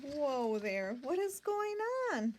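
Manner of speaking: ordinary delivery, nothing marked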